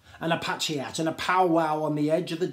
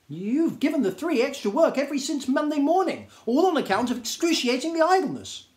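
scolding Djinn; 11 semitones higher, 5 dB louder than usual. Acted.

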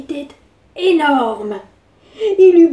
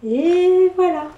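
closing; 5 semitones higher, slower than usual. Shown as a demonstration. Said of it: "Et voilà, les petits loups".

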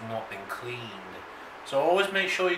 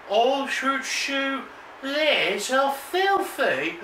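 high, slow creepy voice; 11 semitones higher, much slower than usual. Acted. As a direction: draw out filthy slowly and high. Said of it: "The creepy voice is better than you".

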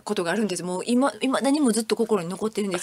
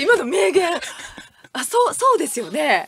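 normal talking voice

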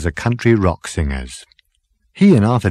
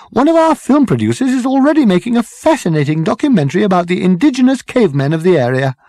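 put-on high, loud, strident girl's voice; 11 semitones higher, 5 dB louder, faster than usual. A narrator's reading. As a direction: cut in stridently, pitched right up. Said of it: "the girl with the strident voice".

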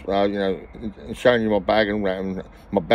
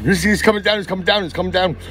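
excited ladies; 9 semitones higher than usual. Retold or imitated. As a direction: squeal it like startled women. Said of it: "the ladies were like".

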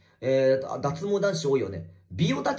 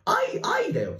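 playful high cry. Pretend.

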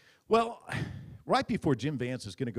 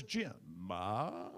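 lightly voiced reporter; 7 semitones lower, 6 dB quieter than usual. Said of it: "and he says".

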